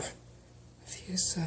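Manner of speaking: slow, soft, dry whisper. Acted. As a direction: fade out like you're too weak. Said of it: "she said in a dry voice".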